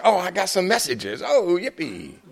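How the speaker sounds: high, loud burst of mock glee; thrilled, high-pitched whoop of delight; excited. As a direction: sing out a gleeful little cheer. Mock glee at blinking answering machine.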